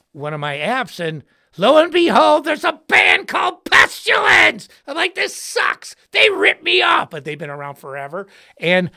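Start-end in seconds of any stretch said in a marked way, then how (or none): 1.6-7.1 high, loud indignant shout; loud mock outrage at a stolen name; outraged yell. Real, not acted. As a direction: cry out betrayed. Outrage at band name taken.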